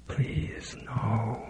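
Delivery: voiceless, desperate whisper; pleading whisper. Acted. Lydia begging the famous killer: pleading whisper. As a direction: beg under your breath.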